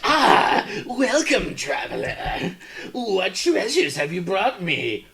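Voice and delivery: high, slow creepy shopkeeper greeting; eccentric merchant. Pretend. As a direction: greet the traveler like a weird merchant. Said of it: "really eccentric, like, hole in the wall kinda guy".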